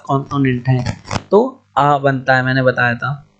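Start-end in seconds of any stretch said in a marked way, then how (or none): none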